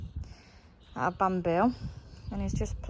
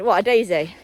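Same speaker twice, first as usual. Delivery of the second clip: ordinary talk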